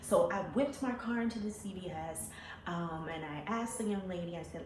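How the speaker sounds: plain reading voice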